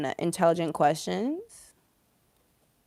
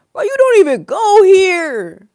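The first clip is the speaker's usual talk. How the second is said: high, loud, stretched-out protest; mock Queen of Swords; 11 semitones higher, 10 dB louder, slower than usual. Card's queen tells off an outsider, exaggerated exasperation. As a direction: wail it slowly at the intruder. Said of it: "She's like".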